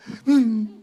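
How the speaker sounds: high, bitten-back giggle; shy tongue laugh. Shown as a demonstration. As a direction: imitate laughing while biting the tongue. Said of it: "even the shy tongue laugh".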